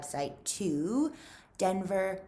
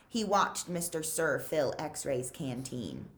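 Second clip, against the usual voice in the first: usual speaking voice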